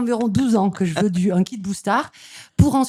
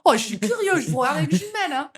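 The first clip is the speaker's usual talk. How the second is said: put-on high Marseille accent; squeaky hammed-up Marseille accent; 9 semitones higher than usual. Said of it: "tu peux faire ton accent".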